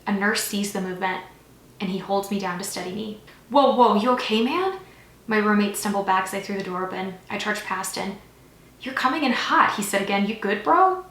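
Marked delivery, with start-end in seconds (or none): 3.5-4.8 loud, higher voice rising sharply at the end; startled concern. A narrator's reading. Roommate stumbles back from the door, startled concern. 8.8-9.8 startled remark. A narrator's reading. Startled roommate remarks on entry. 10.2-11.0 raised, high questioning lilt; concerned roommate. A narrator's reading. Roommate to friend charging past, concerned questioning.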